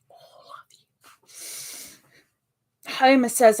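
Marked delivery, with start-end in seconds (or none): 0.1-0.9 barely audible whisper; hushed, voiceless repeat; whispered echo. Pretend. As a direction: whisper it as an afterthought. Hushed echo of her greeting.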